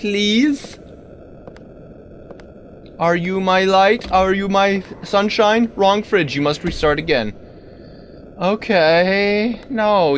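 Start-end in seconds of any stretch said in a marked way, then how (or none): none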